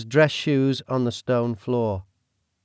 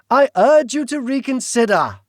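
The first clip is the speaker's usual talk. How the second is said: British villain voice; 13 semitones higher, 6 dB louder than usual. Acted. The Duke speaks.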